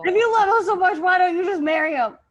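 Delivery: loud, high jealous snap; jealous outburst. Acted. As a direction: fling it at God like a jilted sibling.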